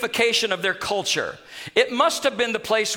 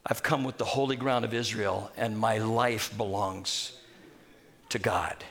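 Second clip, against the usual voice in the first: much lower and softer, slowing to a hush; hushed vow; 10 semitones lower, 8 dB quieter than usual. Retold or imitated. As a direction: let the vow sink into reverence. Naaman's resolve, sinking reverent.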